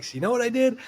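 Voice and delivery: high-pitched storyteller's quote; imitated indignant musician; acted punchline setup. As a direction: pitch it way up to set up the reveal.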